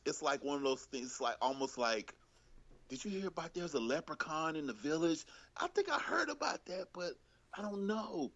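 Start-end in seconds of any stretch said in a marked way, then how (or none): 2.9-8.3 high, wide-eyed gossip voice; mock gossip voice. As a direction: play a breathless village gossip. Mock gossip about brief news.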